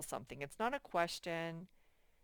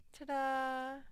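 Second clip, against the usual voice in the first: sing-song ta-da; 7 semitones higher than usual. Real, not acted. Finishes the exercise.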